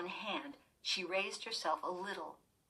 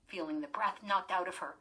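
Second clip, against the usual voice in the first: ordinary delivery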